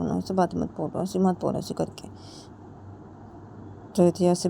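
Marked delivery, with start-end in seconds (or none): 0.0-2.1 breathy voiceless whisper mimicking her; mock whisper. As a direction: whisper the ऐसे करके back at her.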